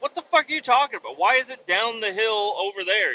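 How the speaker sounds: high, rushed retold outburst easing slightly; incredulous exasperation. Customer hears truck is down the hill, incredulous. Retold disbelief at mechanic's news.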